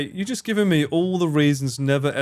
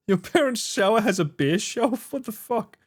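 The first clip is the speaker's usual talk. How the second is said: higher, disbelieving question; stunned incredulity; incredulous; 7 semitones higher than usual. Real, not acted. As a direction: react in amazement. Shocked by beer shelf.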